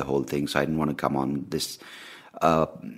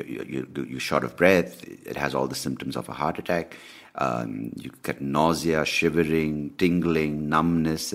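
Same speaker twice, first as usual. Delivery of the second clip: normal talking voice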